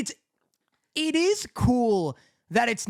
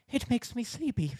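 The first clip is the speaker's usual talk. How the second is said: much softer, drowsy little voice; sleepy girl voice; 3 semitones higher, 7 dB quieter than usual. Retold or imitated. Quoting the blue-haired girl's sleepy reply, soft and drowsy. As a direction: murmur it like you're nodding off. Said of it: "And she's like".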